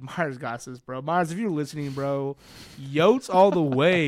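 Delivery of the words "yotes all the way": drawn-out loud rallying cry; team cheer. Real, not acted. Co-host rooting for the Yotes, drawn-out cheer. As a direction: cheer them on, loud and slow.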